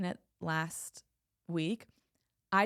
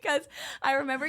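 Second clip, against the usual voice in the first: laughing; 7 semitones higher, 4 dB louder, faster than usual. Real, not acted. Laughing insistence.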